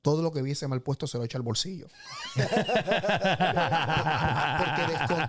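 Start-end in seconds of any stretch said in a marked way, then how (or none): 1.9-3.5 bright burst of high laughter. Real, not acted. Laughing while telling about his father pocketing things.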